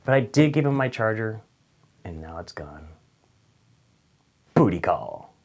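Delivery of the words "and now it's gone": soft unvoiced aside; whisper. Real, not acted. Vlogger lamenting the lost charger, whispered. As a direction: drop it to a secret whisper. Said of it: "whispered punchline".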